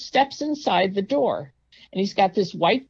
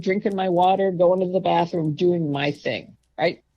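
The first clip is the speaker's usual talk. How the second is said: normal talking voice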